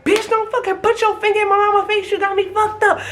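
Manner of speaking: high, heated telling off; furious. Retold or imitated. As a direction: go off on her. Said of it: "I'm like".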